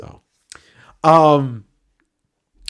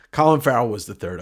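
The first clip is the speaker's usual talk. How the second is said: ordinary delivery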